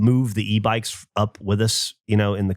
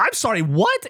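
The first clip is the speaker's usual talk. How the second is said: confused; 10 semitones higher than usual. Retold or imitated. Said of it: "And I go".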